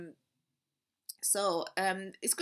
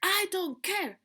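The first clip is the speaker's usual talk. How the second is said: much higher and louder, snapping back at him; tantrum shout; 13 semitones higher, 10 dB louder than usual. Retold or imitated. To her partner who made excuses, a higher, louder tantrum yell. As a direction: yell it in a childish rage. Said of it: "That part just took over".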